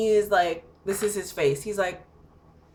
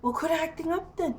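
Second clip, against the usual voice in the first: imitated grumble, higher and a little softer; mimicked unbothered partner in a higher voice; retold sleepy dismissal; 9 semitones higher, 4 dB quieter than usual. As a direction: brush someone off half-awake, pitched up. Sleepy partner rolls over, dismisses her.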